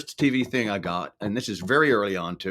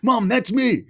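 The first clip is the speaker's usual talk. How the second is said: much higher and louder, bursting with pride; excited shout to mom; 13 semitones higher, 7 dB louder, slower than usual. Retold or imitated. Young singer telling his mom about the radio ad, thrilled. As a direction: call out to mom, overjoyed.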